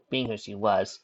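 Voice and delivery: usual speaking voice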